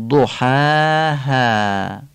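ضحاها very slow, with a guttural ح and light alifs; recited with thin alifs. Shown as a demonstration. Teacher recites ضحاها in full tajwid style, slow and light. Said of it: "الف ها رو هم ترقیق کنیم".

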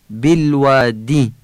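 بالوادی slow and high, with a clear Arabic w; careful classical Arabic بالوادی, every sound full; eloquent Arabic articulation. Shown as a demonstration. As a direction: stretch the long aa in بالوادی. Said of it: "باید فصیح بگیم و الف بالوادی".

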